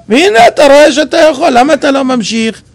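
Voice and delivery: usual speaking voice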